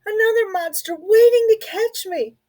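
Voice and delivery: high, tearful squeak; scared mouse voice. Acted. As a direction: whimper it near tears. Little Mouse terrified.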